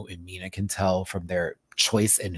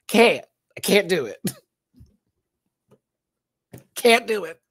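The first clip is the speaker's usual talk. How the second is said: emphatic refusal; 9 semitones higher, 5 dB louder than usual. Real, not acted. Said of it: "refusing, higher and louder".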